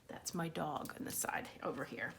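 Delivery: quick unvoiced whisper; whispered aside. Real, not acted. Librarian whispers to the kids about her dog. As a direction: murmur it off to the side.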